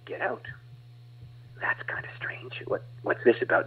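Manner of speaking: soft, unvoiced bewildered whisper; whispered bafflement at the command; puzzled whisper. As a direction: murmur to yourself in confusion. His puzzled reply to preacher.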